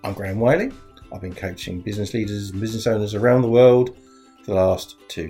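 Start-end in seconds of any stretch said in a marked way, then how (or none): none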